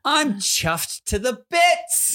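high, very slow, hammed-up British; British accent attempt. Shown as a demonstration. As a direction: draw it out in exaggerated British. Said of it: "give our best shot of doing it".

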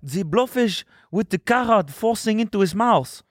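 put-on high, hammy French accent; mock French accent. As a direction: roll the r in carrot.

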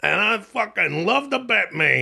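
high-pitched Long Island Batman fan; hammed-up Long Island enthusiasm; Long Island accent. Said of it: "that's a Long Island accent".

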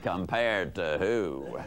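low, slow, sullen comeback; soft, downbeat mock grumble; glum retort. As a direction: imitate a worn-out agent, low and slow. Glum gate agent answers back.

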